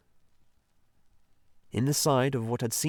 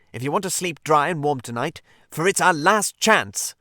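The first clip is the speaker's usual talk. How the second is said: angry command; 6 semitones higher, 7 dB louder than usual. Acted. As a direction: lay down the law. Rat angrily orders the Mole.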